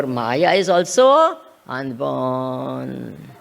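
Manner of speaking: slow, low and deliberate; slow emphatic. Real, not acted. Teacher stresses key point.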